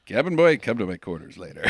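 low, soft, creepy aside; hushed mock-seductive order; mock suggestive captain.

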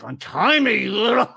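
imitating Batman losing it; angry Batman. Retelling the cartoon, Batman snapping at Robin in mock rage. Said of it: "so many subtle shades".

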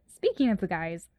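ordinary talk, nothing marked

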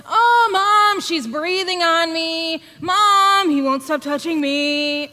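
put-on whiny kids in back seat. Kids in the back seat complaining to Mom, whiny and high. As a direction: play the squabbling siblings tattling to Mom. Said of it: "They're in the back seat going".

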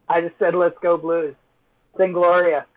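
ordinary delivery

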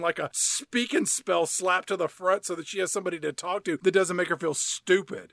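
ordinary delivery

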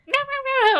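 high-pitched imitated yapping; playful high yap noises; yappy dog. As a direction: mimic a small dog's piercing yaps. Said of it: "I hear a yappy dog".

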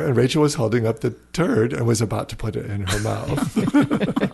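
normal talking voice

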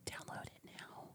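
breathy sultry whisper; sultry narrator. Pretend. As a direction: whisper it seductively, like an ad. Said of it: "In your most sultry voice".

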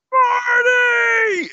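very high, loud calling shout. Retold or imitated. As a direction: yell the name across a crowded hall. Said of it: "And I scream out".

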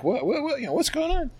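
wife woken up, high and confused; startled wife. Retold or imitated. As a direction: jolt awake, baffled and high-pitched. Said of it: "and she's like".

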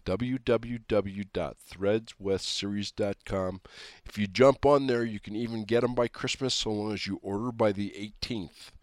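usual speaking voice

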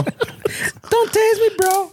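high, slow mock plea; beg, drawn out and high; high pleading voice. Mock plea about the taser.

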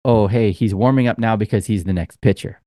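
ordinary delivery

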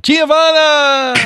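high, stretched-out holler of a name; drawn-out yell. Acted. Jumper calling out a name on the way down, drawn-out yell. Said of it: "Barry yells out".

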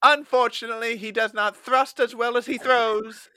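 high, loud announcer voice; sports commentator. Retold or imitated. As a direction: deliver it like a commentator.

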